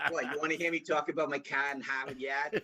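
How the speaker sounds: broad Boston R-dropping, through amusement; Boston accent. Shown as a demonstration. Guest showing off his hometown speech, playful Boston accent. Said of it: "Boston accent just comes flying out".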